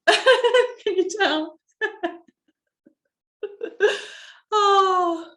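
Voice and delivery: high, loud laugh breaking into a cry. Real, not acted. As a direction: laugh through the tears. Laughs at her own emotion.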